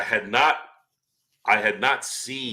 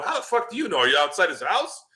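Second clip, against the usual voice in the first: much higher, exasperated disbelief; incredulous; 8 semitones higher than usual. Retold or imitated. Mocking the reporter's claim, incredulous. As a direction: squeak the question in disbelief. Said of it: "And I'm sitting there going".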